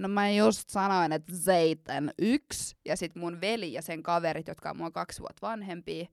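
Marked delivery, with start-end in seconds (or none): 0.0-2.8 put-on slow, louder drunken call from the bathroom; mock drunk yell. To her mother from the toilet, slow mock drunken yell. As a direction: drag it out like a tipsy teen shouting. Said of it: "ja sit mä huudan sieltä silleen".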